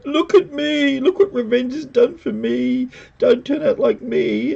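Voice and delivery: high, tearful mock plea; hammed-up anguished warning; the Hound. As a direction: wail it like a melodramatic wreck. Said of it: "the Hound turns around and says".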